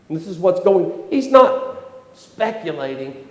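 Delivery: ordinary talk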